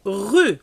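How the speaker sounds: "Rue" said as ru, E not sounded; silent E. Shown as a demonstration. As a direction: say "Rue" short, no final E. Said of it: "Le E, on le prononce pas". ("Rue" sans prononcer le E)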